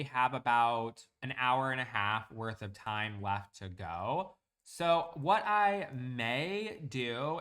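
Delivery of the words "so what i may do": "may" stretched out slow and leaned on hard; stress on may. Real, not acted. Streamer hedging weekend plans to viewers, heavy stress on "may". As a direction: lean heavily on "may", drawn out and louder. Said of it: "I'm emphasizing the word may".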